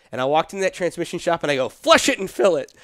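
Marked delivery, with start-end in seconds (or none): 1.7-2.7 loud, high retold command; bold order. Telling the transmission shop what to do, boldly loud. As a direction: call it out with full conviction.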